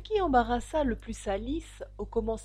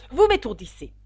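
loud, raised-pitch complaint; irritated shout; 6 semitones higher, 11 dB louder than usual. A narrator's reading. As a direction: cry out as an exasperated queen. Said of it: "cria la reine". (cri agacé)

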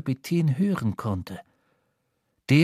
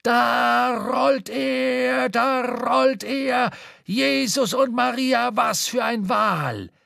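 high, slow, drawn-out sailor's shout; a sailor at the rigging bellowing in awe; excited cry; 8 semitones higher, much slower than usual. A narrator's reading. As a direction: cry out at the sight of the whale. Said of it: "auf einmal ausrief".

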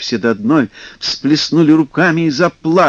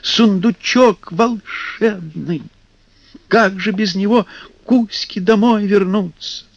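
pleading; 7 semitones higher than usual. Acted. Mermaids plead.

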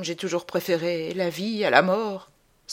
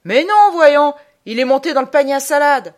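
high, very loud exclamation; crying out; 9 semitones higher, 12 dB louder than usual. A narrator's reading. Omnibus conductor spotting the man at the door, crying out loudly. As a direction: shout it out, pitched well above normal. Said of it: "s'écrie en me voyant".

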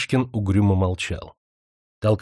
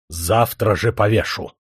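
угроза. Acted. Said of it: "на прощание пригрозил".

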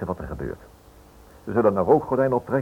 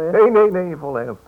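eager protest; 10 semitones higher, 7 dB louder, slower than usual. Acted. Insists inspecteur was serious.

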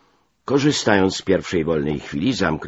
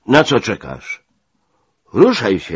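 loud, raised officer's order; impatient command; 5 semitones higher, 8 dB louder than usual. A narrator's reading. Major hurries the stunned narrator, loud and impatient. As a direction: order a dawdler to get moving.

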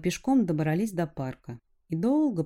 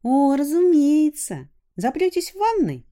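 put-on teasing girl voice; 10 semitones higher, 9 dB louder than usual. A narrator's reading. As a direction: tease him in a high girlish voice. Narrator voices teasing girl Alina.

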